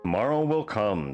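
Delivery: plain reading voice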